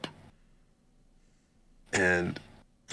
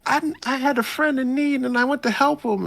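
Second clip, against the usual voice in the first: put-on much higher, a little louder girlish voice; high squeaky Kushida impression; 3 dB louder than usual. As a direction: squeak it out like a flustered girl. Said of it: "And Kushida's like".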